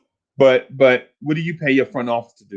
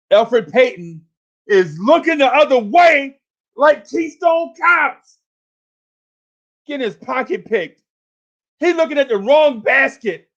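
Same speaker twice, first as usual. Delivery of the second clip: mocking yell; 8 semitones higher than usual. Real, not acted. Fan yelling at Elfrid Payton's defense, mocking shout. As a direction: shout down a player's blunders. Mocking a player, high and loud.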